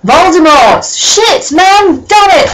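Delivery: high, loud hammed-up dismay; mock shock. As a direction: overplay the surprise, yelling.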